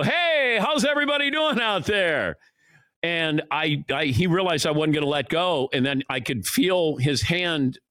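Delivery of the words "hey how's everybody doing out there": showman greeting. Retold or imitated. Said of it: "he wants to go".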